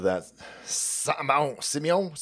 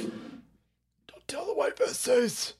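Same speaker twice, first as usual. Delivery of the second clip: breathy secret whisper, much softer; 8 semitones higher, 7 dB quieter, much slower than usual. Acted. Streamer whispering a secret to viewers, unvoiced whisper. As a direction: hush it like a secret kept from the wife.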